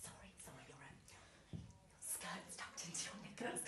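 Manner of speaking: hushed, breathy whisper of an embarrassed colleague; whispered warning. Retold or imitated. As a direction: whisper it so no one else hears. Colleague's discreet warning at drinks machine.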